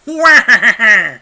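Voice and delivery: loud, high villain cackle; Wario laugh. Acted. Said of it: "they're all Wario now".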